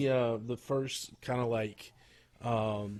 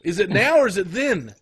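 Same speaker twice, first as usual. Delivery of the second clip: mock exasperated yell; 13 semitones higher, 13 dB louder, faster than usual. Mocking the promo.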